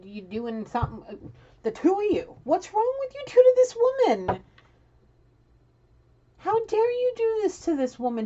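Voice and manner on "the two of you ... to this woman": high-pitched, loud scolding; cursing out. Real, not acted. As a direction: tear into them, voice shooting way up. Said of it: "I'm cursing you out".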